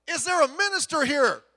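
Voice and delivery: loud, high urgent call; calling for a minister. Acted. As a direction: shout out to the crowd for a minister. Crowd calling out in joke.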